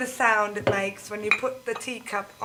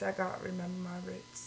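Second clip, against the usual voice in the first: much softer, back to proper English; posh English accent again; 5 semitones lower, 14 dB quieter, faster than usual. Shown as a demonstration.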